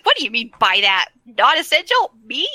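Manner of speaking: offended, spluttering; mock-outraged Bran; indignant Bran. Bran sputtering in retold scene.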